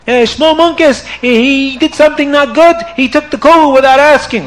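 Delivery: tattle on the chassid; upset complaint. Acted. Rebbetzin complains to her husband, high aggrieved whine. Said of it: "she was so upset".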